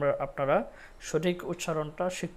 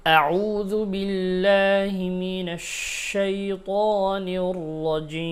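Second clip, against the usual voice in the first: slow, chanted tilawat, higher and louder; Quranic recitation; 5 semitones higher, 8 dB louder, much slower than usual. Acted. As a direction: intone it slowly like a reciter. (ধীর সুরেলা তিলাওয়াত)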